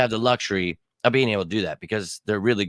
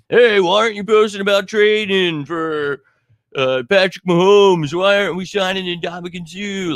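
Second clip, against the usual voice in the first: mocking a fan; 6 semitones higher, 4 dB louder than usual. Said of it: "people don't like that I post".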